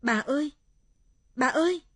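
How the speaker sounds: high, loud call for grandma; searching shout that climbs. Acted. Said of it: "Tôi đi trong rừng gọi bà".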